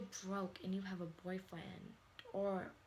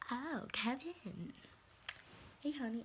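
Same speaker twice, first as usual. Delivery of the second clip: sweet mom greeting; 5 semitones higher than usual. Retold or imitated. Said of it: "She'd be like".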